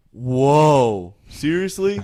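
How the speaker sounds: loud, rising amazed whoa; loud mock amazement. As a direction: act floored by the money. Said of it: "he's like".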